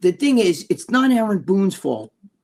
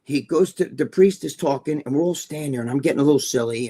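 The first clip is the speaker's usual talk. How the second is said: ordinary talk, nothing marked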